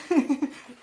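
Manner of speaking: loud laugh at her own blank; a sudden burst of laughter at herself. Real, not acted. Laughs at her own word search.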